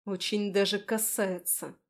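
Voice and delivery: ordinary delivery, nothing marked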